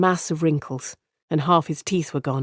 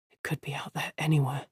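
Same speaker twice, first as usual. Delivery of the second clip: "It could be out there anywhere" hushed and much softer; inner thought; 11 dB quieter than usual. Acted. Rand fearing the fade in the dark, hushed inner voice. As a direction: keep it inside his head, barely voiced. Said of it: "Rand thought".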